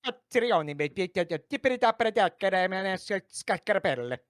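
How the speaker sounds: high, loud fake Italian gibberish. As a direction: ham up Italian nonsense. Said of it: "Yeah, just do it".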